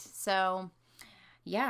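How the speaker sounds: usual speaking voice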